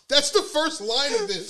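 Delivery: high, loud laughing voice; laughing hard. Real, not acted. Host cracks up at the food description, real laughter. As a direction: squeeze the words out through laughter.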